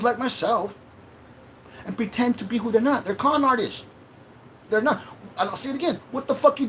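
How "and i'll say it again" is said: ordinary talk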